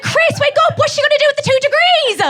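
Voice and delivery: very high, strained squeal of excitement; hammed-up hysterical high holler; frantic excited shout. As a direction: yell to wake him, beside yourself over the story. Escalated wake-up call to boyfriend.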